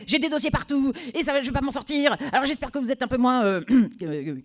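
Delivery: high, loud, overwhelmed ranting boss; frantic boss. Retold or imitated. Stressed boss complaining to the new temp. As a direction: pour out the panic of a swamped boss.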